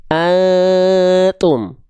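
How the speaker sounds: very long hum in "antum", tongue hovering for t; slow chanted "antum" with suspended nasal; concealed n before t. Shown as a demonstration. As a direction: sustain the masked n of "antum", then release "tum". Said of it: "Lidahnya masih bebas dia".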